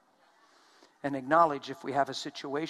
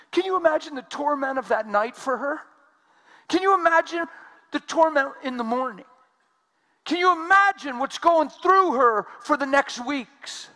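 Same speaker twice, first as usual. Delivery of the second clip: much higher and louder, pleading sermon climb; full-force preaching; 10 semitones higher, 10 dB louder than usual. Acted. Preacher urging the congregation to feel her torment, loud. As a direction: hammer the repeated question at the crowd.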